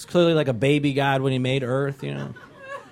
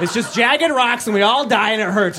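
gleeful doom; 6 semitones higher than usual. Retold or imitated. Atheist gloating about death.

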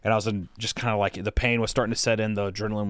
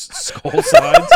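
laughing through the words, high and slow; 12 dB louder than usual. Real, not acted. Laughing while telling friends about his slides. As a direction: giggle out the words.

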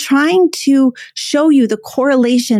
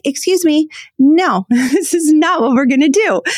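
higher, firm, half laughing stop; firm interruption; 5 semitones higher than usual. Retold or imitated. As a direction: cut them off with a laugh and a firm no. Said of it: "go in there and be like".

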